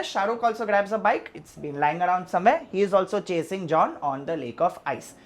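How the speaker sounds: ordinary talk, nothing marked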